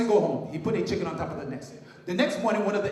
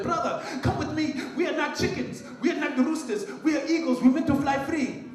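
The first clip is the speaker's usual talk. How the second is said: high, eager call to his brother; rally him, bright and urgent; excited urging; 7 semitones higher than usual. Acted. Young eagle urging his brother to fly, excited and high.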